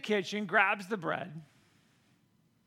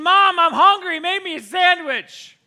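put-on loud, high kid yell to the kitchen; yelled demand; 8 semitones higher, 11 dB louder than usual. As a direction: yell for mom from the next room, stretching it out. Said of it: "So I yelled in the kitchen".

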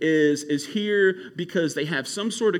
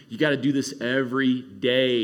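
earnest plea; 6 semitones lower than usual. Real, not acted. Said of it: "closing, earnest urging".

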